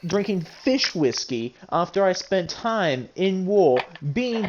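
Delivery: high-pitched, exasperated outburst; agitated rant. Acted. Arguing with a friend over fate, exasperated. Acted heated argument about fate.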